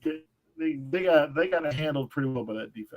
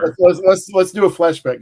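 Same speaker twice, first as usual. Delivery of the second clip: loud announcement; 4 semitones higher, 10 dB louder than usual. Acted. Host loudly announces flashback segment.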